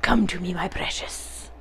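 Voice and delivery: whispered creature luring its treasure; creepy covetous whisper. Acted. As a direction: beckon greedily in a hushed whisper.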